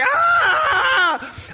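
piercing, very high, loud mock scream; retold scream of panic. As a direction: scream as if stung by a bee. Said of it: "I'm like".

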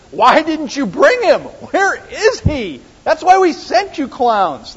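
high, loud exasperated demanding; exasperated leaders. Acted. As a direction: berate underlings in high-pitched exasperation.

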